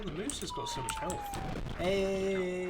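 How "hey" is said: loud, higher drawn-out hey; cheering. Real, not acted. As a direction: cheer as help arrives. Greeting a rescuer in game.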